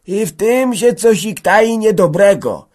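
put-on very high, slow, loud old man's voice; stammering old man. A narrator's reading. Old peasant sensing something bad, high stammering voice. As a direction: wail it slowly, high up. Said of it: "zająkał stary Bylica".

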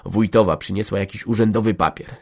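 plain reading voice

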